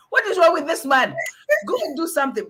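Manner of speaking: exasperated disbelief. Acted. Speaker reacting to a potbellied man, exasperated disbelief. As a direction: exclaim it as if appalled by him. Acts out reaction to such men.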